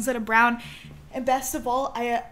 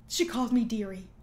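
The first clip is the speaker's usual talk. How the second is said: usual speaking voice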